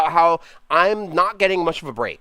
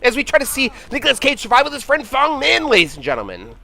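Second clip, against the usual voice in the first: sportscaster voice; 7 semitones higher than usual. Acted. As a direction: hype up the game like a TV sportscaster. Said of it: "the sportscaster voice".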